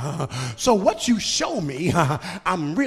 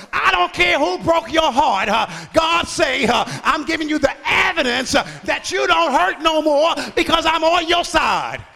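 higher, ringing preacher's shout; full-force preaching; 7 semitones higher, 5 dB louder than usual. Acted. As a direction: proclaim God's promise to the congregation.

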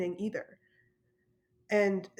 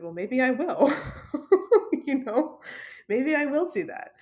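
laughing; 6 semitones higher, 5 dB louder than usual. Real, not acted. Joking back at the official.

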